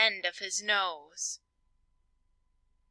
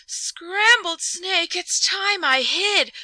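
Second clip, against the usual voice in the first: higher, louder panicked snake; scared snake; 8 semitones higher, 7 dB louder, slower than usual. Acted. Snake panicking at hearing of scrambled snake. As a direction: gasp it out and flee.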